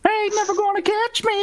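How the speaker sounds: very high squeaky sing-out; playful squeal of a creature getting away; high taunting voice. Acted. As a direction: tease them in a silly falsetto. Player taunts as fleeing boar.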